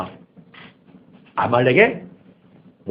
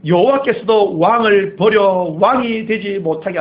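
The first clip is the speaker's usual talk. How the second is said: higher, louder prophetic reading; solemn scripture rebuke; 5 semitones higher, 4 dB louder than usual. Acted. Samuel's rebuke read aloud.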